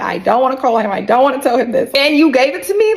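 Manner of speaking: upset plea, rising high at the end; retold tearful protest. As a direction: imitate a distressed wail, building to a high question. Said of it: "Now I'm really crying".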